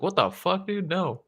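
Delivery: high-pitched incredulous retort, lightly played; mock disbelief. Retelling his teenage refusal of a catheter, mock disbelief.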